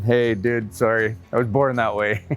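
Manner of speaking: ordinary delivery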